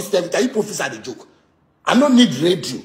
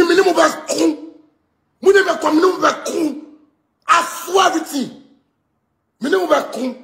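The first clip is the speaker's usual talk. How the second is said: heated insistence; 5 semitones higher than usual. Real, not acted. Heated rant, insisting and swearing.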